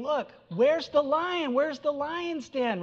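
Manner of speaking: high, slow, sneering taunt of a fallen enemy; preacher voicing a prophet mocking a fallen city, high and sneering. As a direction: jeer at them in a high, drawn-out voice. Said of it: "He's mocking them".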